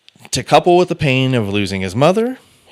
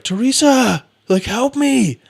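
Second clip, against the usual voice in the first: high-pitched mock cry for help; mock yelling for help; slower than usual. Shot husband calls to his wife, high mock yell. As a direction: imitate a wounded man calling out, high. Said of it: "he's yelling for her".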